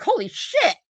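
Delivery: high astonished exclaim; amazed. Retold or imitated. Sloan Bella reacting to the video, high amazed exclaim. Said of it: "she's like".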